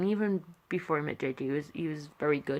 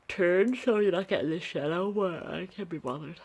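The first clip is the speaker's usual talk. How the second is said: talking through a yawn; words stretched by a yawn. Real, not acted. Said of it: "I'm sorry, I'm yawning".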